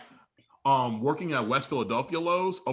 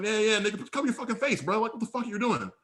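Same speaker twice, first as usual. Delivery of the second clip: high-pitched indignant back-talk; acted fed-up telling-off; retold exasperation; 6 semitones higher, faster than usual. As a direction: replay arguing back, voice pitched up. Retold argument with screaming stranger.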